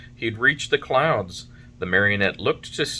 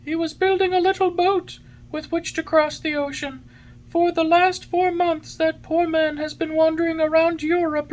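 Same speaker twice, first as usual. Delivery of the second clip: very high, louder bird voice; pigeon voice; 3 dB louder than usual. A narrator's reading. Pigeon tells Pinocchio where his father was, in pigeon voice.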